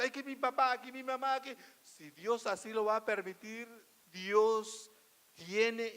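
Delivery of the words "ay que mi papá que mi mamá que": high, loud mock wail; mock lamenting. Preacher mimicking believers who lament over their parents, mock lamenting. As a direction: imitate someone bemoaning their lot, pitched up. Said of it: "No hay que lamentarse".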